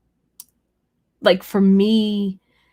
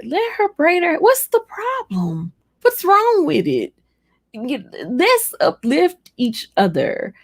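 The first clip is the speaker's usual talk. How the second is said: exasperated; 7 semitones higher than usual. Real, not acted. Defending women's hair choices.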